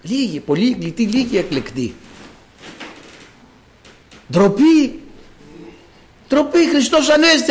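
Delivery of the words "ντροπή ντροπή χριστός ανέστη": raised-pitch cry of shame; exclaimed reproach. Acted. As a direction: rebuke the congregation with a ringing exclamation. Preacher exclaims shame to congregation. (επιτιμητικό αναφώνημα)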